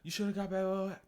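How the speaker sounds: high, loud mock coach yell. Coach yelling at a player in the game, mock yelling. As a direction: holler like a coach on the sideline. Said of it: "they're yelling, they're saying".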